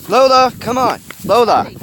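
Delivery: real loud, high call; calling a dog. Real, not acted. Owner calling Lola away, insistent. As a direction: call out sharply to a straying dog.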